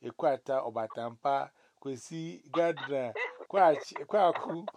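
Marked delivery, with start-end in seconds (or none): none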